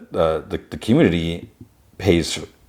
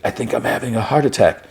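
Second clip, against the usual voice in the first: higher-pitched, mildly panicky retelling; retold panic; 5 semitones higher than usual. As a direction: imitate someone lightly panicking. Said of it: "starts getting a panic attack".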